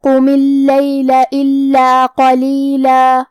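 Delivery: slow, drawn-out tajweed chant; measured Quran recitation; Quranic recitation. Shown as a demonstration. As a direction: recite each letter slowly with full tajweed. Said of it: "کلمت قم".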